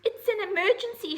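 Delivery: high, loud urgent cry; shout. Acted. Little owl shouting to the horse, high and loud. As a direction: shout it like the sky is falling. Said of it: "shouted Ozzy".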